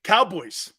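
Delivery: ordinary talk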